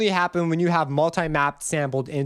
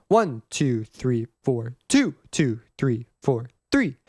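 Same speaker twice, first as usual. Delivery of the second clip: counting bars with "one", then "two", then "three" punched as each downbeat; emphasis on beat one; 4 semitones lower, slower than usual. Shown as a demonstration. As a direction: hit the first number of each bar, drop lower on the others. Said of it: "is an emphasis on beat one".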